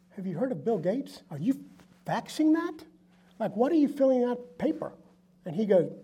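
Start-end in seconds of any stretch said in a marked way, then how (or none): 0.1-4.9 mock disbelief, rising high on the questions; incredulous. Teasing a friend for faxing an order, incredulous.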